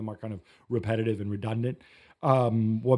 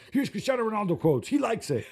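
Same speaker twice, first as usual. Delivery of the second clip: much higher, sing-out mock announcement; mock-eager PR flack; 10 semitones higher than usual. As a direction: parody a publicist rushing out a quote. Mimicking eager PR people sending quotes.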